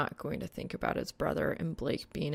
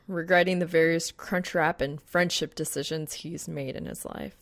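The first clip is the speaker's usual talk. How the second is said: usual speaking voice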